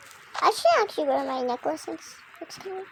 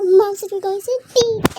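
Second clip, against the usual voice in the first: ordinary talk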